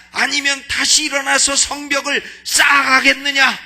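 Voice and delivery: rising sermon shout; preaching at full force. Acted. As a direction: thunder it out to the congregation. Preaching climax, loud.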